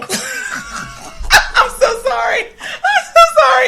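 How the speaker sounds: high shrieking laugh through the words; real laughter breaking the apology. Real, not acted. Laughing at her own accent to her cohost, shrieking. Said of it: "the worst accent ever".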